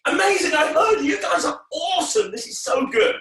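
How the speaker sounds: high, loud, laughing cheer; cheering kid. Shown as a demonstration. Entertainer shows children how to shout back, excited. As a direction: yell it like a thrilled child.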